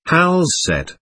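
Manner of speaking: ordinary delivery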